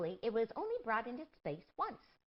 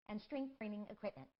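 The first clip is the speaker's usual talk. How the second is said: ordinary talk, nothing marked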